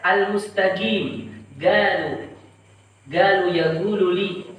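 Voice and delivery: "al-mustaqim" said as "al-mustagim"; hard g for every qaf, as in "galu"; Yemeni-dialect "galu", g replacing q. Shown as a demonstration. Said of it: "Qaf dibaca dengan ga".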